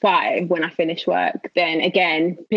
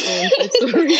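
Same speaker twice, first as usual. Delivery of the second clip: laughing mock apology; 6 semitones higher than usual. Retold apology to partner.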